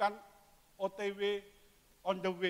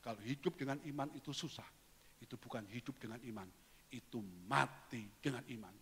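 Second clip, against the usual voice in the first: quieter repeat; 6 semitones lower, 11 dB quieter than usual. Shown as a demonstration.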